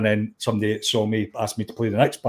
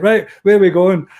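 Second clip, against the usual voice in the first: tour guide call; 7 semitones higher, 7 dB louder than usual. Retold or imitated. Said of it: "and he was like".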